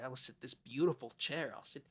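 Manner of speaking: ordinary talk, nothing marked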